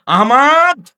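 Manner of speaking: mother calling her son home, loud and high. Acted. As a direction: call the boy's name sharply.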